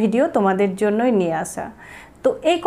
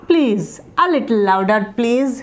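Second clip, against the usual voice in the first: slow polite request; much slower than usual. Shown as a demonstration. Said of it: "অবশ্যই এই কথাটা বলবো".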